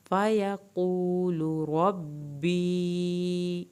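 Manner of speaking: slow, drawn-out melodic tajweed recitation; Quran recitation. Shown as a demonstration. Teacher reciting the verse for students, slow melodic recitation. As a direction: chant the verse slowly, stretching every long vowel.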